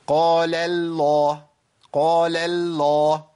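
slow recited قال الله with a wrongly light lam in الله; both lams thinned. Shown as a demonstration. Teacher demonstrates the common mistake, both lams thinned. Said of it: "عشان يرقق اللام بتاعت قال، يسحب معاها".

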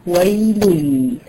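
ويل stretched very slowly in chant; Quran recitation. Shown as a demonstration. Teacher demonstrating ويل, drawn-out. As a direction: hold ويل long, slow, as recited. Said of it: "شايف بيقول إيه؟".

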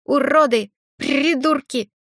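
high, loud squawked insults; shouting. Acted. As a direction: shriek it like an angry parrot. Parrot Grisha shouts insults.

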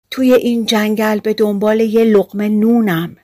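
ordinary delivery

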